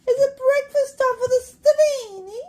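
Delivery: high sing-song coo to the cats; pet talk. Real, not acted. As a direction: ask the cats sweetly, way up high. Cooing at the cats.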